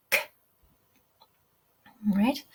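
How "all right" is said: normal talking voice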